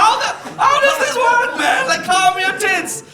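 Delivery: high, loud, laughing disbelief; mock outraged retort, half laughing; exasperated outburst. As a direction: yell it like you can't believe it. Mock exasperated reply to insister.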